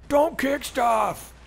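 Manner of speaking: high, slow shouted "Don't kick stuff!"; quoted line, shouted. Retold or imitated. Streamer quoting a favourite line, shouted high and slow. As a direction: bellow "Don't kick stuff!" like a scolding parent. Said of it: "how much I love that line".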